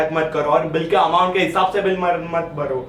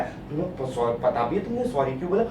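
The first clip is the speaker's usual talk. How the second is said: put-on low, hushed girlfriend questioning; low and soft mock quizzing; quiet interrogation; 6 semitones lower, 8 dB quieter than usual. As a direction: ask it softly, as a loaded test. Girlfriend quizzing him about his sorry.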